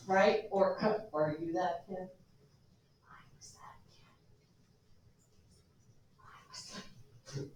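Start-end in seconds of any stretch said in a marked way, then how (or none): 3.0-4.0 whispered confession to the class. Real, not acted. Teacher confesses being hyper kid. 6.2-7.5 soft whispered repeat; whisper again. Real, not acted. Repeats the confession.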